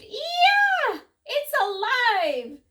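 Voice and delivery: shrieking very high and loud; terrified scream. A narrator's reading. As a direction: scream in horror, slow on alive. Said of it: "The man screamed".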